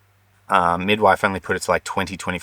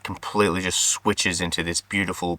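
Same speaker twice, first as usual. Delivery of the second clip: normal talking voice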